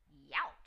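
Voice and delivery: loud pained yelp; cried youch. A narrator's reading. Goldilocks hits the hard chair, loud yelp. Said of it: "she cried".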